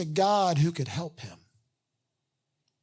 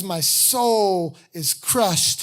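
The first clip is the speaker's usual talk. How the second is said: soul stretched very slow with a rise; slow weighty quote; much slower than usual. Retold or imitated. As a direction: let soul hang, slowly. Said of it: "And then he says".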